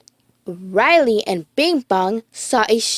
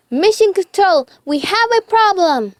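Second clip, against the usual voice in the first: Riley voice; 8 semitones higher, 4 dB louder than usual. A narrator's reading. Riley on radio, rocket falling.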